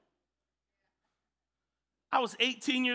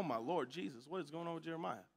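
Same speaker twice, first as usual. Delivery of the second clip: muttered aside; 3 semitones lower, 7 dB quieter than usual. Retold or imitated. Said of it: "and I'm like".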